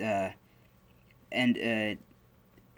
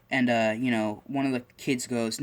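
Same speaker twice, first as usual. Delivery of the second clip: ordinary delivery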